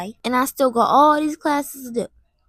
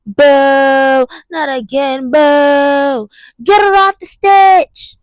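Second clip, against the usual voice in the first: loud, slow booing jeers; mock booing crowd; 6 dB louder, much slower than usual. Playing the crowd booing her off stage, loud jeers. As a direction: jeer like a crowd throwing tomatoes.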